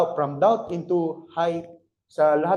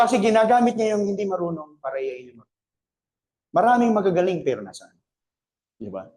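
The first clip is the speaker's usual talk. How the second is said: ordinary talk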